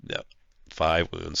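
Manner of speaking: ordinary delivery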